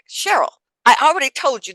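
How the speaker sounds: loud, high and fast, fed up; exasperated, talk over her quickly; annoyed. Retold or imitated. [name] telling Cheryl she already said no, exasperated. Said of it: "I'm so annoyed".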